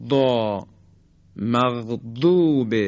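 مغضوب slow, daad stretched along the tongue sides; مغضوب said with a drawn out daad; daad demo. Shown as a demonstration. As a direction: recite مغضوب slowly with the long daad.

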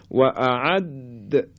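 "اعد" drawn out, د held and released with a bounce; slow down and bounce the د of "اعد"; qalqalah on د. Shown as a demonstration. Teacher models the letter dal in "اعد", bouncing qalqalah. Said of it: "قلقله بدیم".

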